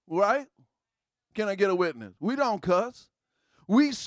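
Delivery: normal talking voice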